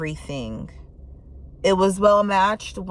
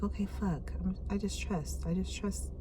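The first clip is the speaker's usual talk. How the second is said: inner monologue; 2 semitones lower, 7 dB quieter than usual. Retold or imitated. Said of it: "When you finally just like".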